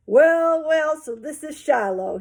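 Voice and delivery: slow, hearty vet's greeting; easygoing welcome, opening high and loud; friendly, calm. A narrator's reading. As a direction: greet the new patient warmly, taking your time. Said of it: "in a friendly, calm kind of voice".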